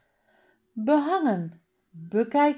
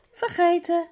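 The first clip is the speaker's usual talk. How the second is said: high, louder exclamation; calling out; 11 semitones higher, 5 dB louder than usual. A narrator's reading. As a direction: call it out as if both at once, pitched up. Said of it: "roepen ze allebei". (hoog en luid uitgeroepen)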